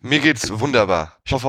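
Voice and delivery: ordinary delivery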